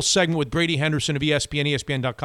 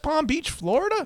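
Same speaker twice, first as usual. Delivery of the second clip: high disbelieving rise; surprised; 12 semitones higher than usual. Real, not acted. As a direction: squeak it out in disbelief. Amazed at shared birthplace.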